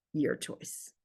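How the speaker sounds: soft breathy whisper, almost no voice; a dramatic whispered payoff. Shown as a demonstration. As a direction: let the two words drop to a hush. Revised line read dramatically.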